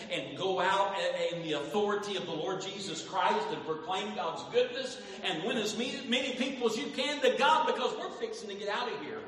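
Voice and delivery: rising to a loud, high preaching peak; building preacher's cry. Acted. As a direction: drive it up to the climax.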